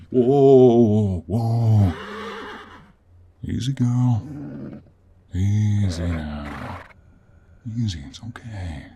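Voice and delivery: calm a spooked mare, slowly; long falling, settling tones; soothing a horse. Acted.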